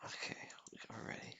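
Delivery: soft whispered cue that the video is about to start; whispering to the chat that they're ready. Real, not acted. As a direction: hush down to a breathy whisper. Hushing before the video plays.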